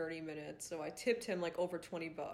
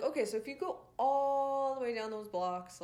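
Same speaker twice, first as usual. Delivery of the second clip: lightly voiced higher, louder direction-giver; stretched-out, raised-pitch helpful local; stranger giving directions; 6 semitones higher, 5 dB louder than usual. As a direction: act out someone pointing out a long route.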